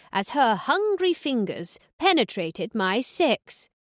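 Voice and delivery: mock-grand recital of a bodice-ripper; reading aloud. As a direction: read it out like a performance, savouring each line. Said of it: "cleared her throat".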